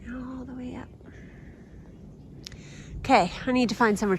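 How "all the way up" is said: hushed, wondering whisper. Real, not acted. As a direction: whisper it in awe. Following paw prints quietly.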